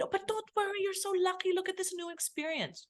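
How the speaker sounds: high, bright mock consoling adult; cheery brush-off. Adult cheerily telling the uprooted child not to worry, mock-upbeat consoling. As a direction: imitate grown-ups chirpily glossing over a child's loss.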